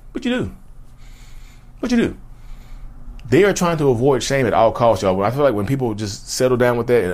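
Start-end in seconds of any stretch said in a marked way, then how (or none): 0.1-2.2 very high squeaky question; mock incredulous. Mocking the abuser, a squeaky high question. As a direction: squeak it in disbelief.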